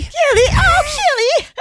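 high, loud joyful shout; excited shout. Real, not acted. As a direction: cheer the name out. Shouting the remembered name.

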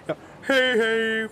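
high, loud, drawn-out goodbye; long sustained farewell holler; departing superhero call. Acted. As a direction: call out slowly as you swing away. Second attempt at superhero exit.

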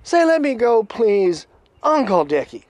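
imitated loud, high demand; Critter. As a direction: dictate the words forcefully. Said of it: "And then he says".

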